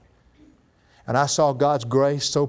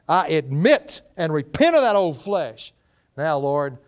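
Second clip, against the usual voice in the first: ordinary talk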